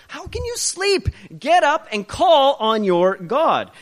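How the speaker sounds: high, loud, urgent captain rousing a sleeper; urgent ship captain. Acted. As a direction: shake him awake with an alarmed, slow cry. Captain rouses sleeping Jonah.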